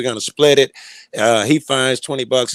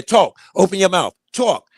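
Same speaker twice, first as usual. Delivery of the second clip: agitated demand; 6 semitones higher than usual. Retold or imitated. Friend demands he speak aloud.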